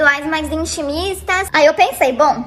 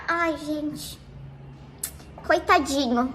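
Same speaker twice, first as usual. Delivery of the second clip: much softer, fake-sweet sigh; mock pity; 3 semitones lower, 9 dB quieter, much slower than usual. Narrator mocking the weepy guy, softer fake pity. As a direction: pretend to pity the poor thing.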